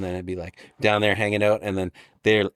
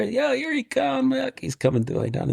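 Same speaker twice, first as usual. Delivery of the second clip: mock excited buddy; 14 semitones higher than usual. Voicing a buddy watching.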